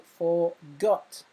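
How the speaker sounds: "forgot" with the weight on "got", rising at the end; stress on GOT. Shown as a demonstration. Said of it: "the final syllable is stressed".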